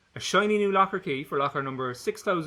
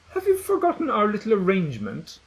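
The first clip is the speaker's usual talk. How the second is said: put-on bossy girl; 7 semitones higher, 5 dB louder than usual. Gretchen voiced in story.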